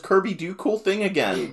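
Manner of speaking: put-on high, laughing childish demand; Tiff voice. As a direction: ask like a simple kid. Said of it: "she says".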